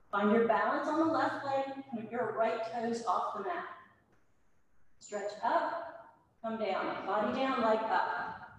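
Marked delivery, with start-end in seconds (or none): none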